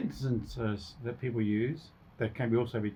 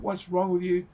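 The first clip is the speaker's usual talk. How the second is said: higher-pitched, worked-up demand; heightened voice; 6 semitones higher than usual. Acted. Frustrated person confronting someone in a discussion, heightened voice. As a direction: act out raised-pitch exasperation. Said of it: "you're fairly heightened if your voice goes".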